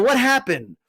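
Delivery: normal talking voice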